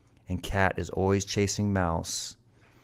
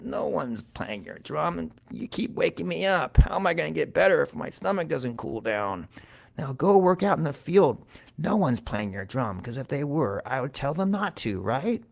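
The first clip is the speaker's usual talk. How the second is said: whining sick mouse; 7 semitones higher than usual. A narrator's reading. Sick mouse sends Cat back to work, higher whiny protest. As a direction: complain like a woken invalid.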